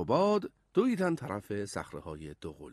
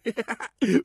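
high, loud short laugh; boy's giggle; 11 semitones higher, 6 dB louder than usual. A narrator's reading. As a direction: laugh as you see your brother. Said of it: "لبخند زد و گفت".

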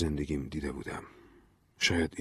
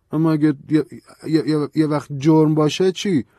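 anxious stammer; 10 semitones higher, 8 dB louder than usual. Acted. Stammering fear about crime.

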